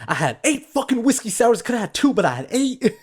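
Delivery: mock freak-out. Quoting the actor's trailer breakdown, mock freak-out. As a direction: imitate a meltdown, emphatic on the count. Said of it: "like, a complete freak out".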